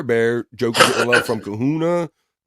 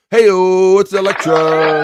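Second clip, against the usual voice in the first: loud, higher drawn-out hey-o; hype greeting; 7 semitones higher, 7 dB louder, much slower than usual. Acted. Host hyping Electra's arrival. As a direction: call it out like an announcer.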